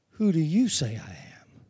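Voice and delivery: low, soft, intimate question; hushed quoted Jesus. Retold or imitated. Preacher voicing Jesus asking Peter, hushed and low. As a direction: ask it quietly, eyes fixed on him.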